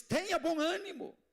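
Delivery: high, ringing rallying cry; rousing exclamation. Retold or imitated. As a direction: proclaim it high to lift the congregation. Preacher exclaims Jesus's encouragement.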